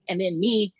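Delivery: ordinary delivery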